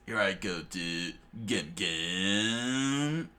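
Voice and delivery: usual speaking voice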